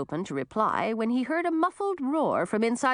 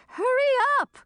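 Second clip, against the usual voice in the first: very high, urgent cry; 4 dB louder than usual. A narrator's reading. Old woman stuck in a hole to rescuers, crying out. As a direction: cry out to hurry them. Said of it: "cried Frizella".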